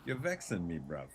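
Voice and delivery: lightly voiced London accent; British accent imitation. Streamer showing the streamer's accent in mock London. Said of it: "he has that exact same accent".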